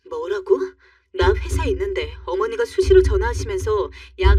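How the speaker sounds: furious wife. Acted. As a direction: hit back at him with everything. Wife fights back at husband.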